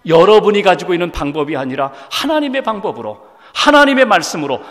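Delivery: usual speaking voice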